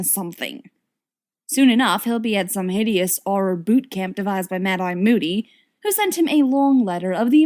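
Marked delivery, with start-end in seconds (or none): none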